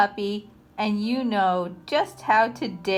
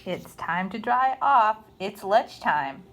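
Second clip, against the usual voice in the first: ordinary delivery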